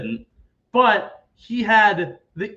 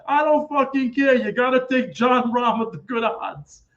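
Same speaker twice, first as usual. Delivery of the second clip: higher, louder impatient mock snap; imitating Barry's insistence; 6 semitones higher, 3 dB louder than usual. Barry telling Steve to take Jon Rahm, mock impatient snap. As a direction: brush him off in a put-on voice.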